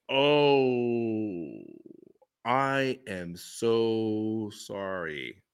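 very slow, low and soft; sincere condolence. Retold or imitated. Me apologizing to him about his late wife, slow and soft. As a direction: draw it out gently, like a shocked apology.

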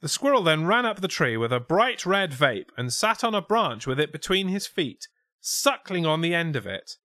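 lifted, projected mock-Scouse reading; put-on Scouse witness. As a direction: read the witness statement in hammed-up Scouse. Reads witness quote in put-on accent.

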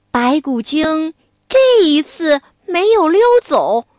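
usual speaking voice

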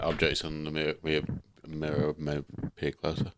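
low, soft, stumbling mumble; tongue-tied and trailing away quietly; garbled, muddled mumble. Real, not acted. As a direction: lose your way through the sentence, tripping over words. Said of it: "Did you get any of that sentence?".

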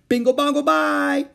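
very high, loud chant; shouted. A narrator's reading. Howie shouting nonsense to make noise. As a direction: yell nonsense just for noise. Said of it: "they screamed at the tops of their voices".

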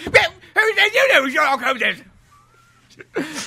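high, loud, slurred-out urchin squawk; coked-out street urchin. Acted. Sherlock announcing himself as a coked-out street urchin. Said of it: "coked out street urchin".

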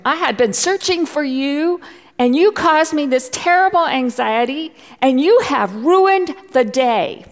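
high loud furious scolding; mother scolding. Retold or imitated. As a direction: let the lost child have it, full blast. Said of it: "that's when I let him have it".

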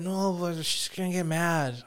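put-on slow, mildly whiny drunk plea; whiny drunk friend. Drunk friend fretting to his buddy about his mom, slow whine. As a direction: drag it out like a worried drunk. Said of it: "He's like".

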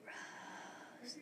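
breathy unvoiced whisper of a name; ghostly whisper. A narrator's reading. Ghost calling the girl's name in a whisper. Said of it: "a whisper came from the wind".

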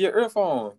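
high call about the earphone. Retold or imitated. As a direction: call him back to his earphone. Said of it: "She said".

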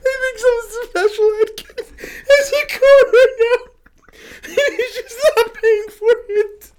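very high wheezy squeal, crying laughing; real laughing fit. Real, not acted. Telling co-host who has the car, helpless laughter. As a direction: squeeze each word out through laughter. Cracking up at own punchline.

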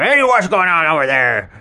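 loud, high tiger call. Acted. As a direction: shout up into the trees. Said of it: "the tiger really looked up".

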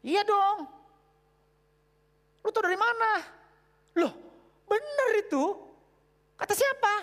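high, loud, glib replies; high loud glib back-and-forth; cocky believer. Retold or imitated. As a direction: voice a smug youth sure of himself. Acted dialogue of shallow believer.

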